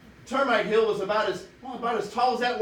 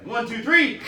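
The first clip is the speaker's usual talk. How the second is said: louder count; 5 semitones higher, 7 dB louder, slower than usual. Shown as a demonstration. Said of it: "You can do better".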